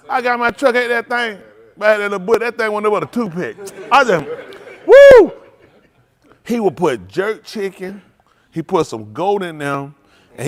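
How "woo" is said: loud, high woo of shock at the heat; a yelp at the spice; shrill whoop of surprise. Retold or imitated. Reacts to spicy food.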